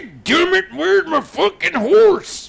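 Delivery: very high, loud, slow cowboy holler; cowboy voice audition. Acted. Auditioning for video game voice work, hammy loud cowboy. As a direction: ham it up like a cartoon cowpoke. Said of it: "I am available for".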